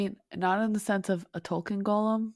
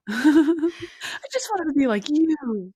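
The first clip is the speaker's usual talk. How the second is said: put-on higher, louder, slow childish whine; mock whiny son; 6 semitones higher, 8 dB louder, slower than usual. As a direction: whine it like a kid wanting praise. S1 mocks Aule sucking up.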